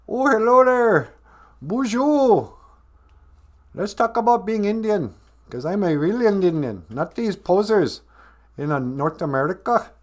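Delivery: put-on terrible Indian accent, pitched high; badly faked Indian accent, hammed up; Sanjay Gupta. Host pretending to be Sanjay Gupta, terrible mock Indian accent. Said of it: "That's a terrible accent".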